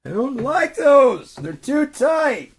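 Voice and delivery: high, drawn-out tantrum scream; screaming little kid. Acted. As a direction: wail it slowly like a kid refusing clothes. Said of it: "he just kept screaming like a little kid".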